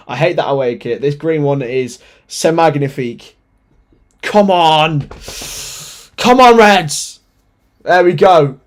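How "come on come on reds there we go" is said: loud, high, slow fan call; cheering shout. Real, not acted. Fan cheers on Liverpool before kickoff, loud shout. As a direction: call out to the team like from the stands.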